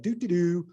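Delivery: high carefree mock hum; clueless humming. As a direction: hum along like you have no idea. Showing up clueless at Chicago.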